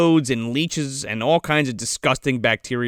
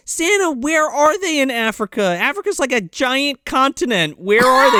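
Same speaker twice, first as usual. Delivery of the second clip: lightly voiced exasperated questioning, high-pitched; imitated Mary Ellen; 8 semitones higher than usual. Mary Ellen questioning Santa about Africa, high mock exasperation. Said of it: "And she's like".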